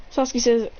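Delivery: ordinary delivery, nothing marked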